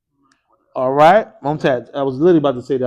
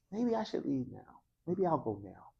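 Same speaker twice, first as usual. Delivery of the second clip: soft, unsure thinking aloud; doubtful musing; 12 dB quieter than usual. Shown as a demonstration. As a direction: wonder it quietly, half to yourself. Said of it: "It's, it's doubt. It's maybe".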